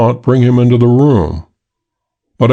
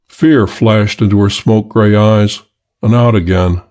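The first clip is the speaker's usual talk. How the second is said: ordinary delivery, nothing marked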